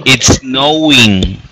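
It's snowing said slowly; slow it down for the student to copy; careful classroom model. Shown as a demonstration. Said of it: "repítelas conmigo".

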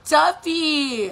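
high, loud, drawn-out name call; jokey scolding. Retold or imitated. Mom calling out her toddler, joking mock scold. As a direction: sing out the child's name in mock warning. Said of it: "I try to make it into a joke".

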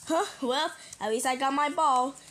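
ordinary delivery

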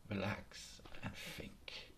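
whispered, drawn out, soothing; slow calming whisper. Acted. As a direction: breathe it out slowly to calm her. Porcupine calms deer slowly.

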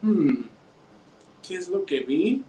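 puzzled little pot thinking aloud; musing hum, then a slightly high question; storyteller acting out mild puzzlement; pondering. A narrator's reading. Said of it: "la ollita pensaba y pensaba".

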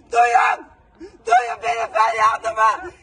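sobbing shout, slow and dragged out; desperate crying shout. Real, not acted. Mother demands to be heard, crying and shouting. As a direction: cry out so everyone hears.

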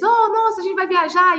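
high-pitched, thrilled exclamation; mock overjoyed husband. Husband hears of the promised trip, mock delight. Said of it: "o marido já vai ficar todo feliz".

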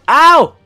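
high, loud "ow"; pained yelp. Real, not acted. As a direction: yelp at a sudden hit. Game character takes a hit.

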